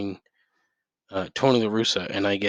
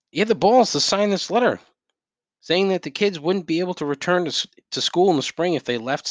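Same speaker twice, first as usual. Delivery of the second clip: indignant rant; 8 semitones higher than usual. Real, not acted. Ranting about the university president's letter, outraged. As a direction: let outrage push the pitch up.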